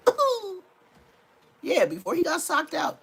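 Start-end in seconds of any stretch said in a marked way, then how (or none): 0.1-0.6 much higher mock ooh of pain; imitated ooh. Mocking the knocked-out fighter's high ooh. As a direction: coo out a hurt little ooh. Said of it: "You already heard him say".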